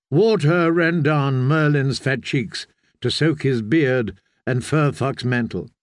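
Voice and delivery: plain reading voice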